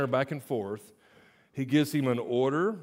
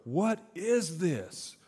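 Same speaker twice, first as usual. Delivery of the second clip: slow, raised, wondering question; amazed; 5 semitones higher, slower than usual. Acted. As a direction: ask it in astonishment. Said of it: "they asked each other".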